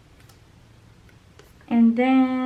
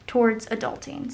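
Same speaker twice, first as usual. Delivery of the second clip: normal talking voice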